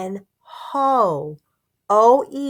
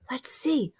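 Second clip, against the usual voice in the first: whisper; 3 semitones higher, 10 dB quieter than usual. Acted. Teacher whispers to children.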